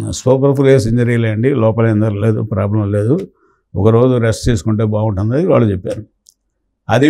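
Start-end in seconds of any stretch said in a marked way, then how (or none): none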